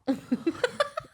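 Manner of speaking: bright giggle breaking out; high laugh after a dismissive quote; high burst of laughter. Real, not acted. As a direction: laugh at the own joke. Laughing at own impression.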